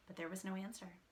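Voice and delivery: usual speaking voice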